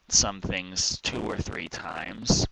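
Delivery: ordinary delivery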